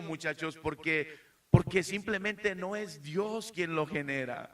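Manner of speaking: rising passionate preaching; building sermon. Acted. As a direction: drive the point home from the pulpit. Preacher rising to full force.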